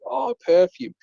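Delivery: high-pitched imitation of mum's delight; mock delighted reaction to a gift; pleased surprise. Mum opens birthday gift in story.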